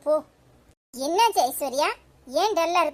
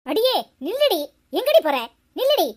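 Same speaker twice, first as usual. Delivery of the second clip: furious, loud, higher yell to stop her; angry shout; 4 semitones higher, 5 dB louder than usual. Acted. Mother shouting after her daughter as she walks away, furious. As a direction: bellow at her to stand still.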